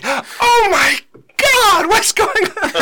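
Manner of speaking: high, loud panicked cry; mom's shocked cry. Retold or imitated. Mom crying out as her son yells in church. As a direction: gasp it out in horror, half laughing. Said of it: "my mom's like".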